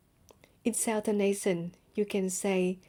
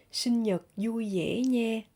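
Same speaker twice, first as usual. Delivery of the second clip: Southern Vietnamese accent; 2 semitones higher than usual. Shown as a demonstration. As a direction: say "vui vẻ" the Southern Vietnamese way, V softened towards Y.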